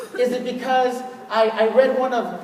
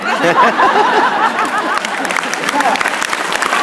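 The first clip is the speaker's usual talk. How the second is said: hearty laugh; 7 semitones higher, 5 dB louder than usual. Real, not acted. Laughs at own joke.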